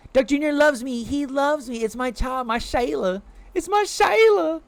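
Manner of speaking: high, cooing pet voice. Real, not acted. Cooing to chat about her beloved duck.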